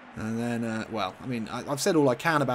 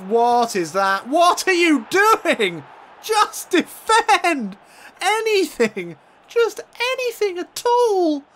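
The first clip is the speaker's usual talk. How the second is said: screamed much higher and louder, slow and drawn out; furious shout; 8 dB louder, much slower than usual. Real, not acted. Gamer screaming at his defenders to clear it, furious drawn-out shout. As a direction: let rip at the useless defence.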